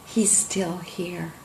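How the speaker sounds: slow breathless whisper, barely voiced; awed whisper. Little girl whispering to Grammy on seeing the drawing of her kitty, slow and barely voiced. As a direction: hush it out like a small miracle.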